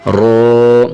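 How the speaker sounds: رو said thick with rounded lips, not a thin را; full rounded رو; heavy ro. Shown as a demonstration. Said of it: "منہ کو تھوڑا سا گول کر کے".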